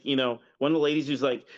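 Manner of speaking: usual speaking voice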